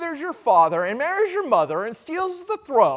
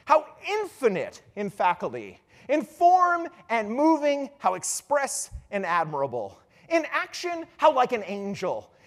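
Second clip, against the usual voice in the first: declaimed in measured phrases with pauses; grand stage recitation, each exclamation set apart; Hamlet. Acted. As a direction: recite it like a soliloquy, swelling on key words. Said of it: "he says".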